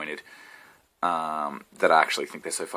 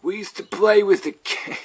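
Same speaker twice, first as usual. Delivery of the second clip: put-on grumpy old man; 14 semitones higher, 6 dB louder, much slower than usual. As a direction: drag it out like a ninety-year-old complaining. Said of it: "I sound like a ninety-year-old man".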